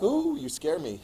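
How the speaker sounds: high, sarcastic "ooh, I'm scared"; hammed-up sarcastic trembling; mock fear. Germans mock Wilson's threat.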